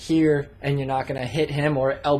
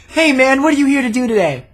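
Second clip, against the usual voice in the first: much higher, much louder, hammed-up pumped instructor; mock the overly peppy skydiving cameraman; energetic instructor; 9 semitones higher, 9 dB louder, faster than usual.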